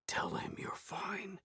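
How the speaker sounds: slow unvoiced whisper. A narrator's reading. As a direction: whisper the threat. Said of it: "he whispered".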